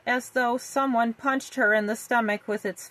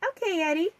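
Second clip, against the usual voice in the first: put-on little girl voice; 7 semitones higher, faster than usual. A narrator's reading. Little sister Vicky voiced.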